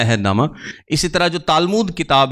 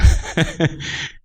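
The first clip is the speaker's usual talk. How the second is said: short unvoiced chuckle; real chuckle; 2 semitones higher than usual. Real, not acted. Teacher after an aside to the class, chuckling. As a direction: chuckle briefly at your own aside.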